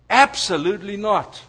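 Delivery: ordinary delivery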